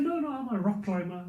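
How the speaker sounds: ordinary talk, nothing marked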